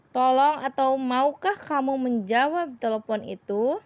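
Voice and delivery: ordinary talk, nothing marked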